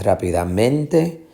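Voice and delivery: rápidamente with MEN stressed, rising. Shown as a demonstration. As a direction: shift the weight of rápidamente onto MEN. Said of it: "la fuerza de pronunciación no está aquí". (rápidamente con acento en MEN)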